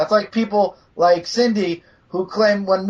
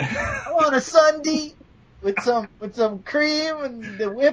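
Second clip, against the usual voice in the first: slow, higher mock voice; playful slow mimicking; teasing mimicry; 6 semitones higher, slower than usual. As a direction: drawl it out high, teasing a slip. Mimics the other's slip, mockingly.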